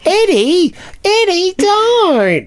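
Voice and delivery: put-on high, drawn-out kid whine; kid drawing out vowels. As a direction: call it out high, stretching the vowels. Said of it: "this kid's drawing out all his vowels".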